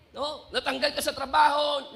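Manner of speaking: normal talking voice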